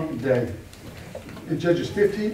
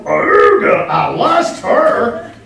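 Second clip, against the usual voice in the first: hammed-up strangled cry, much higher and louder, dragged out slowly; mock lusting Samson; 11 semitones higher, 10 dB louder, much slower than usual. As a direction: overact a lovesick brute.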